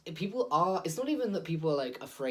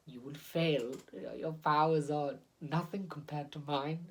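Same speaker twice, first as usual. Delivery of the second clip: stony alien villain. Retold or imitated. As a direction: menace them like an alien overlord, without emotion. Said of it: "And he talks like this".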